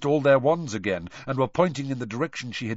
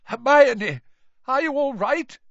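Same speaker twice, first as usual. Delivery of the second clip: high, anxious call; shaky voice; 12 semitones higher, 8 dB louder than usual. Acted. Terrified father checking the children are unhurt, shaky voice. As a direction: check on her, badly shaken. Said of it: "His voice sounded shaky".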